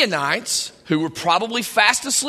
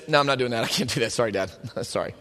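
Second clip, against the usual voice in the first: put-on small, much softer voice of a scared boy; timid kid; 11 semitones lower, 8 dB quieter, faster than usual. The boy refusing his father, small and much softer. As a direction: shrink back, quiet and apologetic. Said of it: "And the kid says".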